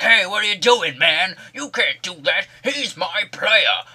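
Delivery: high, loud put-on outcry; mock indignant protest. Everyone protesting the kick on their player, mock indignant outcry. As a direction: protest like an outraged teammate, hammed up.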